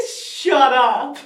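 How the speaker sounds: loud, thrilled disbelieving exclamation; starstruck disbelief. Retold or imitated. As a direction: burst out loudly, as if overwhelmed. Starstruck reaction to Doctor.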